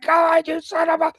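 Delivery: high, loud mock crowd yell; imitated angry yelling. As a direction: holler like a riled-up heckler. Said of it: "yelling at me and getting mad".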